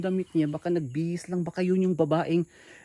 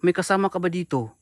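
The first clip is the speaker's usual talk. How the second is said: usual speaking voice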